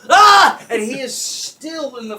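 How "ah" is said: short, high, loud shriek; imitated startled scream. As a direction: mimic a brother's startled scream.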